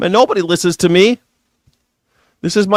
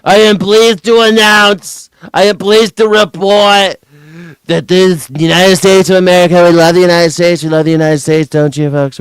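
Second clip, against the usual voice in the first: mock Trump; 2 semitones higher, 4 dB louder than usual. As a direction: ham up a pompous presidential announcement. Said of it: "Donald Trump".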